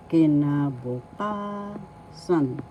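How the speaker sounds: "kinabukasan" slowly, with a long, held KA; stress on ka. Shown as a demonstration. Teacher showing learners the accent, slow "kinabukasan" stressed on KA. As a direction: draw out the fourth syllable of "kinabukasan", then drop on "san". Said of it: "The fourth syllable ka has the accent".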